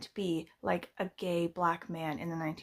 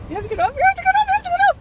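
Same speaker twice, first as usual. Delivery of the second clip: shrill escalating screams; screaming at a spider; 12 dB louder, much faster than usual. Real, not acted. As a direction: scream get off louder each time.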